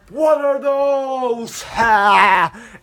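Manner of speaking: very high, slow, loud mocking yell; hammed-up meme shout. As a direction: bellow it to humiliate him. Hogan mocks Goodman's shoes.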